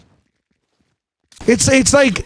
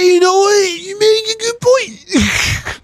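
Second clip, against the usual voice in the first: much higher, strained wailing cartoon voice; mock sobbing shout, dragged slow; squeaky crying shout; 14 semitones higher, slower than usual. Streamer voicing a comic character in squeaky crying shout. Acted reply in funny voice.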